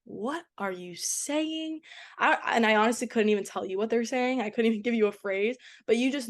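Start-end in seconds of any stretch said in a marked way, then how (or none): 0.0-1.8 confused. Retold or imitated. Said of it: "and I'm like".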